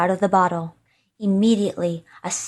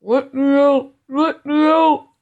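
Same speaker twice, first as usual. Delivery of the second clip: high, raised, muffled plea; smothered cry; 6 semitones higher, 4 dB louder than usual. A narrator's reading. Hidden spirit calls to be freed, smothered cry. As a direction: cry out as if shut away. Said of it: "in a very smothered voice".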